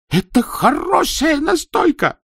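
high, loud frantic shout; desperate protest. Acted. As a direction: protest wildly, beating chest. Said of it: "бил себя в грудь Казопула".

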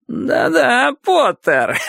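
high, cackling laugh through the words; guffawing. Acted. Boris guffaws, teasing Potter about blushing. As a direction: roar with laughter at him. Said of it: "загоготал он".

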